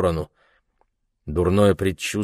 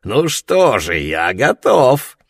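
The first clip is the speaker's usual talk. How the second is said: put-on leering drunk; 4 semitones higher, 5 dB louder, slower than usual.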